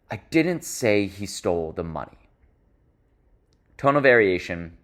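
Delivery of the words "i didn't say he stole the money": whole sentence kept low, falling at the end; lower, softer, settled statement. Shown as a demonstration. Coach showing the contrasting tone across sentences, low falling delivery. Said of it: "very different than if I say".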